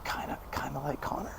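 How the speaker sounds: hushed, near-whispered tease; retold sheepish jibe under the breath; sneaky tease. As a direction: slip it in very softly, playfully. Sneaks the teasing name in again.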